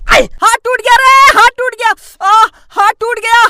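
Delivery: hammed-up wailing over a hurt hand; boy howling after punching a wall; mock pain. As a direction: whine that the hand is broken.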